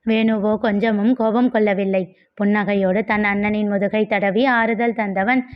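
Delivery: usual speaking voice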